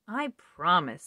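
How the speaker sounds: slow, drawn-out promise; reluctant promise. Acted. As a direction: promise with a heavy sigh in it. Princess promising slowly.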